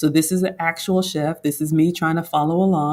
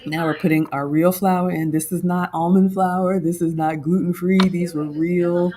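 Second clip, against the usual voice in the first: ordinary delivery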